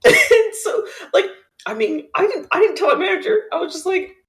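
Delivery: can't stop laughing while telling it; giggly. Real, not acted. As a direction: still cracking up, high and bright. Still laughing at the story.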